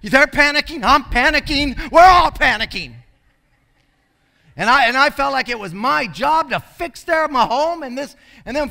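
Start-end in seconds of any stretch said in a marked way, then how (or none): 0.0-3.0 higher and louder, building as he goes; retold panic. Young mission worker frantic over homeless visitors, rising mock panic. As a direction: replay his own frantic alarm, growing louder.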